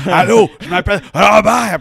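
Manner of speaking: «Robert» with a guttural uvular R, loud and high; throat R demo. Shown as a demonstration. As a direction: say «Allô, je m'appelle Robert» with a forced back-of-throat R. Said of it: "faire des R dans le fond de ma gorge".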